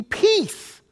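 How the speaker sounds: a touch of Jesus rebuking the storm; calm, firm command, the first word pitched high; lightly voiced rebuke, Peace swooping up. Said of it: "he rebuked the wind and said".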